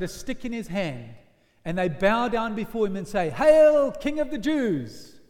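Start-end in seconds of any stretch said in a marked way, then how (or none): 3.3-5.2 loud, high, slow mock homage; mocking hail. Soldiers bowing to Jesus in mockery, loud jeer. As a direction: sneer it like a fake bow. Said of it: "they mock him".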